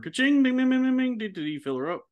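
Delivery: high ka-ching and rapid bing noises; station-tag jingle. Pretend. Host doing the podcast network station tag, mouthed jingle sounds.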